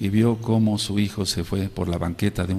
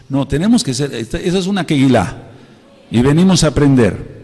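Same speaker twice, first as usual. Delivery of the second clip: loud, higher exhortation; full-force preaching; 4 semitones higher, 7 dB louder than usual. Real, not acted. Pastor urging congregation to learn, loud preaching. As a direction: drive the point home.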